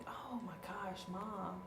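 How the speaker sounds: put-on soft whispered child gush; excited whisper. Nine-year-old whispering excitedly to her mother. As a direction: whisper it like a thrilled kid. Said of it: "nine-year-old was like".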